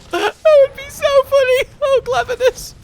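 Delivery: high, loud laughing; laughing squeal. Real, not acted. Laughing high after game moment.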